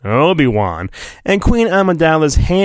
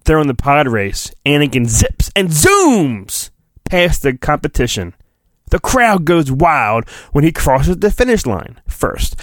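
ordinary delivery, nothing marked

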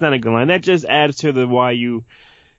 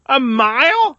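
high-pitched, rising disbelief; astonished question; incredulous; 9 semitones higher, slower than usual. Real, not acted. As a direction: echo it back in disbelief. Incredulous at the answer given.

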